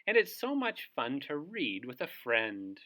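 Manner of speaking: ordinary delivery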